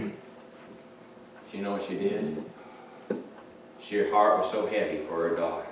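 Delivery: usual speaking voice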